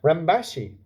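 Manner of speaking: ordinary delivery, nothing marked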